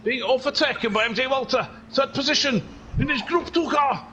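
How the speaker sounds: high, excited Welsh commentary; Welsh accent. Pretend. Commentator following Phil's overtake, excited Welsh accent. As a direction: call the overtake in lilting Welsh. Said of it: "this is an accent I've been doing".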